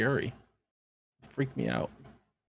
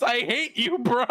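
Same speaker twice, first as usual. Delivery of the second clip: shouting; 10 semitones higher than usual. Retold or imitated. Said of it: "just shouts".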